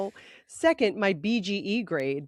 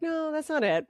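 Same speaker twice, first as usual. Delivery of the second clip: higher, exasperated denial; mock protest; 9 semitones higher than usual. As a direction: insist it's not that, half-shouting. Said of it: "I'm like".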